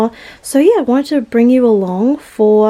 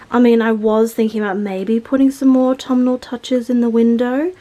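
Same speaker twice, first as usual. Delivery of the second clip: normal talking voice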